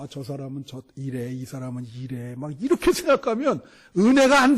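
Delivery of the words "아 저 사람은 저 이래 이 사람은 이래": mock judging murmur. Mocking churchgoers judging others.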